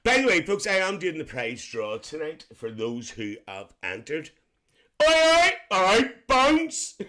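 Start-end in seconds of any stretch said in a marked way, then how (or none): 5.0-7.0 put-on loud, high-pitched English accent greeting. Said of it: "I like that accent".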